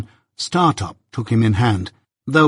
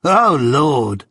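bored lout scoffing at Pip; scornful scoff; 2 semitones higher than usual. A narrator's reading. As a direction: sneer out a mock exclamation. Drummle scoffs again.

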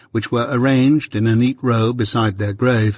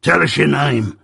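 gruff convict; 3 semitones higher than usual. Acted. Convict interrogates boy.

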